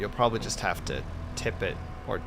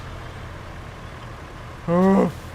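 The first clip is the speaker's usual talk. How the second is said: sharp, loud gasp; startled gasp; 8 semitones higher, 4 dB louder than usual. Real, not acted. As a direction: gasp as you nearly slip. Slips on the bridge.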